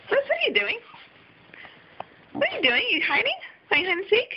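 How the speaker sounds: high teasing coo to a hiding dog. Real, not acted.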